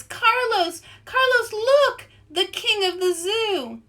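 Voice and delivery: excited calling. Acted. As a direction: tug his tail and shout it. Friend calls out to Carlos.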